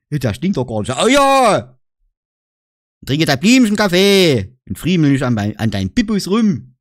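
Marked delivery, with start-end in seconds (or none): 0.9-1.8 higher, louder oh ja; insistent play agreement; mock-dialogue emphatic oh ja. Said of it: "das ist eine Verstärkung".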